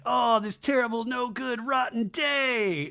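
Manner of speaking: high, stretched-out mock wailing; mock lament. [name] bemoans his day, hammed-up lament. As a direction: wail it high, dragging out the last word.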